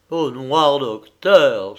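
slow, loud lament, lightly acted; a hint of a sigh, stretched slowly; sighed exclamation. A narrator's reading. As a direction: draw it out like a heavy, theatrical sigh. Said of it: "soupira Stello".

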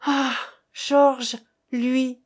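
cri douloureux, plus aigu; exclamation déchirante. A narrator's reading. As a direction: lancer le nom comme une plaie. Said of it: "Elle eut une exclamation déchirante". (Geneviève crie le nom de Georges, déchirée)

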